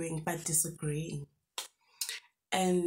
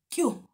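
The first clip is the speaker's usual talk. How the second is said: relieved phew; 7 semitones higher, 5 dB louder than usual. Retold or imitated. Relief at spotting exit.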